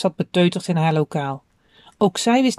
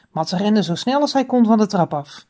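usual speaking voice

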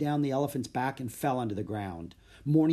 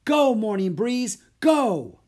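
high, loud urging cries; urgent shout; 10 semitones higher, 9 dB louder, slower than usual. A narrator's reading. Boy spurs elephant to the wild, shouting. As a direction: yell them onward at full voice. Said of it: "His voice rose to a shout".

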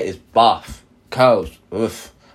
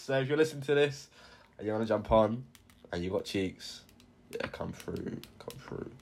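softer and softer until whispered; fading to a whisper; 15 dB quieter than usual. Real, not acted. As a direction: let it die away to a whisper. Trailing off, addressing listeners.

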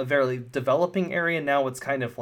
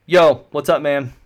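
loud, easygoing greeting; casual engineer; 10 dB louder than usual. Acted. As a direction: hail a new friend like a buddy. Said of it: "he looks at him and he goes".

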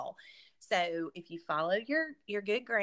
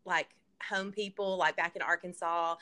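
ordinary talk, nothing marked

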